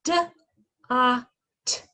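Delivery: D, a, t said as separate sounds; sounding out. Shown as a demonstration. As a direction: sound out each phoneme. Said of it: "let's blend these sounds together".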